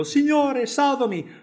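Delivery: high, loud desperate shout; panicked plea shouted high and loud; cry for help. A narrator's reading. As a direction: call out as if drowning. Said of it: "affondare gridò".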